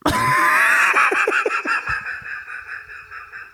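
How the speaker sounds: high breathless cackle; high squeal laugh. Real, not acted. Cracking up at his own alien voice, a high squealing laugh.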